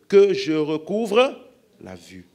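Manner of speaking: loud, slow plea for sight; pleading cry. Acted. Blind man begging Jesus, slow loud cry. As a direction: cry out to be healed.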